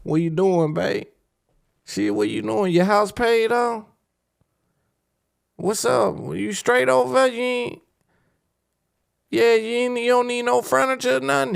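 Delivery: high, eager caller voice; butter her up, high and pushy; high mock-caring holler; hollering sweet talk. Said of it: "calling and hollering".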